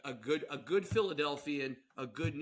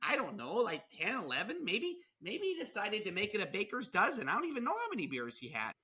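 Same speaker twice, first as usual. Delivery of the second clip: higher, folksy Midwestern excusing; Midwest accent; 5 semitones higher than usual. Acted. Midwesterner excuses a drinker's count, high Midwest accent. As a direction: make light of it in a hammed-up Midwest voice. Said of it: "if it's in the Midwest".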